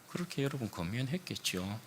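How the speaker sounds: ordinary delivery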